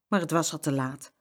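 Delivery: ordinary delivery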